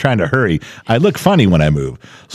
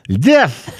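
high, slow and fed up; exasperated insistence; much slower than usual. Retold or imitated. Patient spelling it out to the doctor, exasperated.

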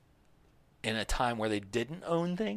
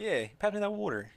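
a Southern twang on "pass me that water", pitched up; mock Southern twang; 8 semitones higher than usual. Joining a friend's Southern twang about water. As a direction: play a Southern local asking for water. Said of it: "I'll get a little twang".

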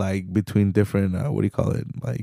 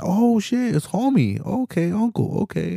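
higher, light eager greeting; excited cousin greeting; 10 semitones higher than usual. Retold or imitated. Cousin greeting his uncle the manager, a higher eager voice. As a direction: sound glad to spot a relative at work.

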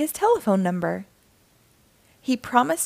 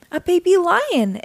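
high, louder delighted cry; excited exclamation; 8 semitones higher, 4 dB louder than usual. Acted. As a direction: exclaim with happy surprise. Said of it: "exclaimed Goby".

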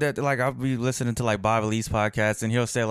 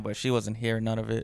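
usual speaking voice

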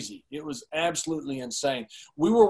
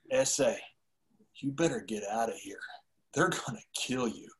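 low, half-whispered retold warning; hushed warning; 6 semitones lower, 8 dB quieter than usual. Retold urgent warning from the mat.

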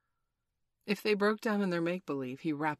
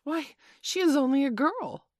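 high, loud indignant protest; aghast; 10 semitones higher, 8 dB louder than usual. A narrator's reading. John objects to serving his sister, aghast. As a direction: protest in shocked disbelief. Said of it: "John aghast".

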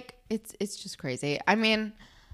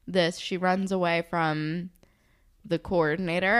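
ordinary talk, nothing marked

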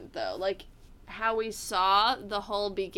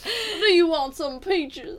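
put-on Southern belle accent; 7 semitones higher, 6 dB louder, slower than usual. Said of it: "The Atlantas and the nice".

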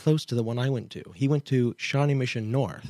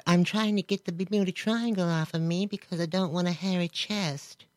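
higher, soft put-on voice; imitated rambling guest; 6 semitones higher, 3 dB quieter than usual. Host mimics the confused woman from the interview, high mimicking voice.

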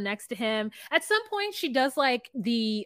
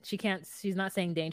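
usual speaking voice

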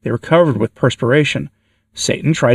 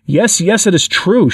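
higher-pitched cry, without real shrieking; a mild, narrated version of a wild shriek; understated demon shriek; 5 semitones higher, slower than usual. A narrator's reading. Demon yielding to the bishop's command, understated high shriek. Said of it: "shrieked the demon wildly".

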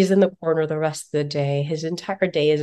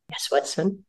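normal talking voice

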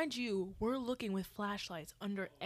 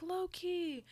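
high call of Loki, reenacted rather than shouted; calling out the cat's name; 10 semitones higher than usual. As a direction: call the name across a dark yard. Calling for the lost cat.

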